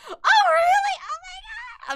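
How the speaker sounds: high, loud squeal of disbelief; flustered delight. Retold or imitated. Fan told her art is cheap, squealing high and loud. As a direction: gasp it out, overwhelmed. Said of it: "I was like".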